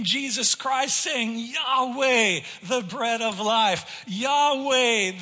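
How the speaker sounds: loud, slow proclaiming of each Yahweh; preaching. Acted. Preacher proclaiming Jesus' names to the church, loud and ringing.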